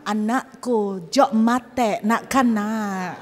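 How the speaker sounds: put-on slow, drawn-out Madurese-accented Indonesian; Madura dialect. A Madurese neighbour calling through the wall, slow Madurese accent. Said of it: "Kalau misalnya orang Madura yang ngomong".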